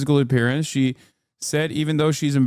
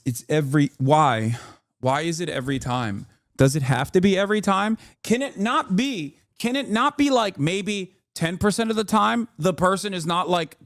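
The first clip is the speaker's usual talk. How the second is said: ordinary talk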